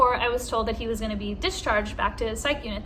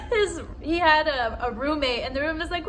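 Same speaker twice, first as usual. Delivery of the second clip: ordinary talk, nothing marked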